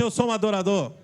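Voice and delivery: loud praise shout for the congregation to repeat; loud call and response. Shown as a demonstration. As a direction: proclaim it loud and firm. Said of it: "Fala assim".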